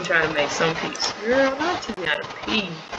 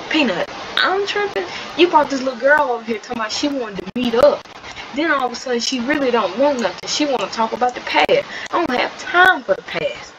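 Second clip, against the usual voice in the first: indignant sister; 3 semitones higher than usual. Acted. Angry sister rants at Peanut about the new girl, indignant. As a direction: argue back, pitch jumping on the questions.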